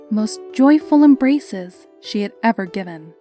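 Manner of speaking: usual speaking voice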